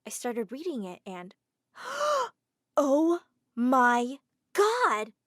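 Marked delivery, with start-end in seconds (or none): 1.8-2.3 high shocked intake of breath; sharp gasp. Acted. Reads shocking letter. 2.8-5.1 loud, very slow, stunned exclamation; shocked. Acted. Reacting to shocking letter.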